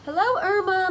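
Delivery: loud, high greeting across the yards; calling out. A narrator's reading. Mother greeting neighbour Irma across the yard, calling out loud and high. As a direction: call out to the neighbour over the garden. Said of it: "my mother called".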